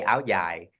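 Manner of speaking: ao dai said "ow yai", southern Vietnamese style; southern Vietnamese "ao dai" with a Y sound in dai; southern Vietnamese accent. Shown as a demonstration. Designer showing how she says it, southern Vietnamese accent. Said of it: "I'm the south, I say".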